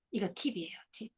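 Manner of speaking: hushed aside to the class; a confiding whispered aside. Real, not acted. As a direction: whisper the tip like a secret.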